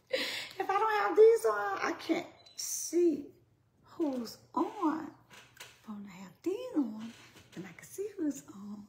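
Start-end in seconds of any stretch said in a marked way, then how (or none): 0.1-5.1 mock complaint. I know it's funny.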